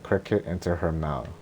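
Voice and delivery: plain reading voice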